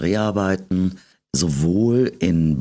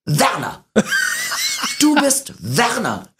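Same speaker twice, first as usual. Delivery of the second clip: high, slow triumphant announcement; mock fan, high and drawn out; slower than usual. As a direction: proudly get the name wrong. Fan naming her wrongly.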